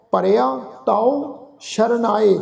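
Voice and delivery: slow, drawn-out chanted reading of the verse; measured Gurbani path recitation; slow scripture recitation. Shown as a demonstration. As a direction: recite each line slowly and reverently.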